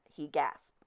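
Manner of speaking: plain reading voice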